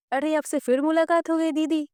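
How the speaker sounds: high, cheery voice of a cheeky companion; high-pitched playful friend; cheeky sidekick voice. A narrator's reading. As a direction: greet her like a jolly mischievous sidekick. Narrator voices Garuda.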